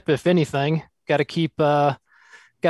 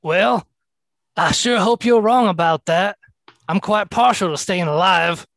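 higher, lilting in-character voice; pitched-up, slightly raised captain voice; captain calling in reply; 5 semitones higher, 4 dB louder than usual. Acted. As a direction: answer the officer with wry politeness. Captain replies to the officer.

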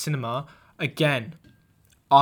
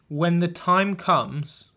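ominous reading; 3 semitones higher, 3 dB louder, much slower than usual. Pretend. Reading a note written in blood.

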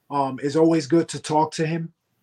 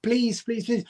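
high, softer mock begging; mock whiny pleading; 4 semitones higher, 5 dB quieter than usual. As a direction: imitate a rival whining for a shot. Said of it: "cry and whine and say".

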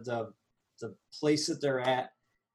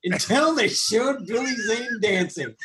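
laughing through the line, louder and higher; 6 semitones higher, 4 dB louder than usual. Real, not acted. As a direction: laugh at the memory as you say it. Laughing echo of co-host.

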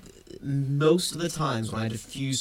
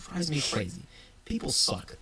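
usual speaking voice

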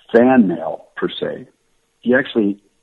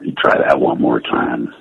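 Wolverine voice; 7 semitones lower than usual. Acted. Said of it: "That's the character I wanted to do".

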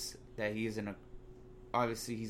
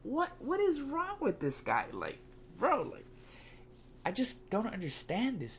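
pitched way up in baffled outrage; exasperated disbelief; 9 semitones higher than usual. Real, not acted. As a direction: throw up your hands, what is wrong with him. Exasperated at the player's antics.

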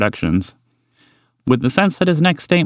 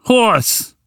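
high falling call, not full volume; shouted call; 12 semitones higher than usual. Acted. Walter confronting an enormous horse, high shouted call. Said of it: "he shouted".

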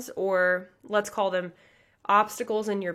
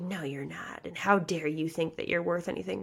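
nasty inner enemy; 3 semitones lower, 5 dB quieter than usual. Retold or imitated. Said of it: "in my mind was like".

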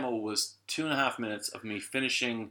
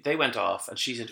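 usual speaking voice

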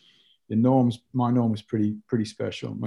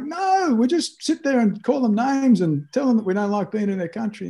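much higher, mocking voice of the other kids; mock kid correction; 11 semitones higher than usual. Classmates telling the new boy the rules, high mock voice.

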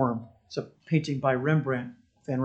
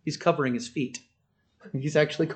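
ordinary talk, nothing marked

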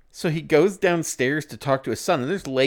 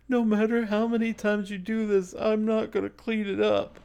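put-on higher-pitched, defiant child refusing; defiant kid voice; 9 semitones higher than usual. Son refuses his father, in a higher defiant kid voice. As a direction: put on a stubborn kid's voice.

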